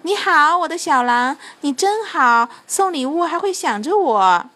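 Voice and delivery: high, loud delighted greeting; calling while running. A narrator's reading. Fairy running to greet Santa, calling out. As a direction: call out gushingly on the run. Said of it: "边跑边喊".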